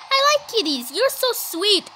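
normal talking voice

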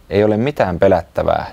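ordinary delivery, nothing marked

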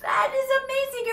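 fast, high, loud cheer; bright fast praise; excited praise. Real, not acted. Teacher cheering after gale blow.

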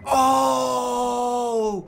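long, loud, high wail of defeat; anguished cry. Real, not acted. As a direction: let out a drawn-out anguished cry. Caught by the pest man.

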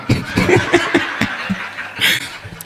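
wheezing high giggle; high breathy laugh. Real, not acted. Comedian laughing at his own punchline.

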